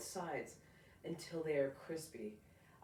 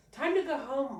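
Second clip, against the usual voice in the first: higher, louder call to leave; a dad calling home time, higher and louder; 9 semitones higher, 11 dB louder than usual. Acted. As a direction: announce it cheerfully to the child.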